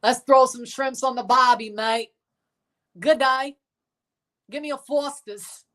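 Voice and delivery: put-on high-pitched hammy Aussie accent; fake Australian accent. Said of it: "A fake Australian accent".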